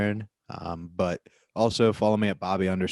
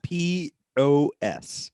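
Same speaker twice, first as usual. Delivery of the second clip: very slow, higher-pitched spelling; exaggeratedly slow; 4 semitones higher, much slower than usual. Real, not acted. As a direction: spell it out letter by letter. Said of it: "Bobby, slow down. You said it too fast".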